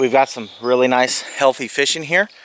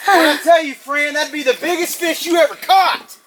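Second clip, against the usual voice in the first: excited; 12 semitones higher, 3 dB louder than usual. Real, not acted. Celebrating daughter's big catch.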